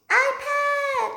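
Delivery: very high, held-out shriek; shrieked cry. Acted. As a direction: wail the word for a lost treasure. Child cries out over missing iPad.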